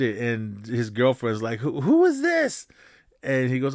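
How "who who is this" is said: put-on high, startled girlfriend voice. Girlfriend catching him with another woman, high and startled. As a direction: squeak it out like a shocked girlfriend.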